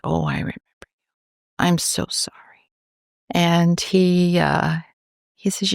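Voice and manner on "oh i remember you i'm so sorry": hushed sympathy. Retold or imitated. Consoling a grieving father.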